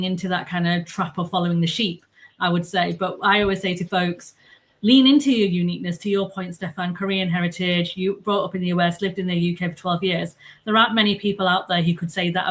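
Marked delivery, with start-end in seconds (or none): none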